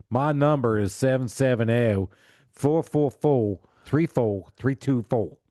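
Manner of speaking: slow, drawn-out Southern digits; thick Southern accent. Retold or imitated. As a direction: imitate a caller with layered Southern drawl. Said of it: "Southern with some Southern on top".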